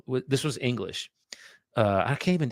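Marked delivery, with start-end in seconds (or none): none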